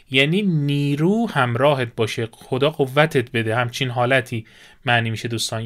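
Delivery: ordinary talk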